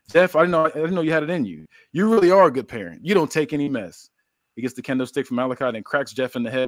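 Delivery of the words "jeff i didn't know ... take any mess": higher, louder villain's praise; acted mock praise. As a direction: put on excited admiration for the victim. Villain mockingly praises Jeff's slap.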